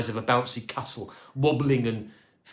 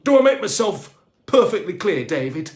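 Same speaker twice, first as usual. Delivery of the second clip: loud, raised pitch, bossy and commanding; stern boss voice; 5 semitones higher, 9 dB louder than usual. A narrator's reading. Boss laying down the law to Dave, stern and loud. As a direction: lay down the law to the cleaner. Grumbleshaw bossing the cleaner.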